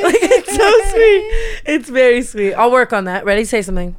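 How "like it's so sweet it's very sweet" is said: high, loud giggly gush; laughing. Real, not acted. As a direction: laugh through the words, much higher. Laughing about Jason's sweetness.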